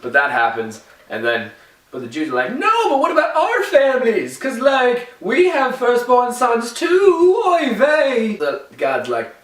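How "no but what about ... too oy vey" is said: mock panicked plea.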